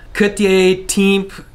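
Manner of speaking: mock American-accented Cât e timp. Shown as a demonstration. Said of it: "When you should say".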